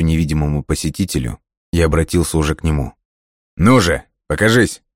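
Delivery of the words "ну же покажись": calling out. A narrator's reading. Calling to invisible intruder.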